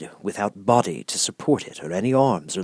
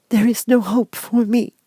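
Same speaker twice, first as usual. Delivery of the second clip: sad; 6 dB louder than usual. Acted. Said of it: "she said sadly".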